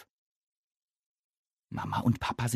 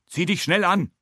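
Papa urging; 9 semitones higher, 5 dB louder than usual. A narrator's reading. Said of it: "Papa sagt wenigstens".

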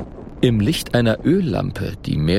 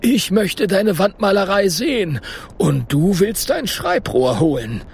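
high, eager professor voice; excited professor; 10 semitones higher than usual. A narrator's reading. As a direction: urge them on, bubbling with curiosity. Eager professor keeps urging.

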